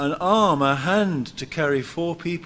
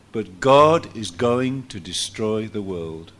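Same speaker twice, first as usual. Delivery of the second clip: normal talking voice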